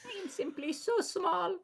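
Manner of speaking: very high, soft tiny voice; little guy voice. Acted. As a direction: plead in a minuscule falsetto. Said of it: "say it in your best little guy voice".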